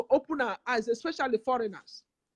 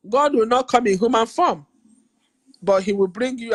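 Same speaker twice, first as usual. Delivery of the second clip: forceful declaration; 12 dB louder than usual. Real, not acted. Emphatic preaching to viewers.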